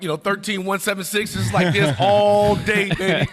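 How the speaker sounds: strut it like you just hit the shot; showing off to teammates, cocky and loud; cocky boast. Retold or imitated. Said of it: "I'm like".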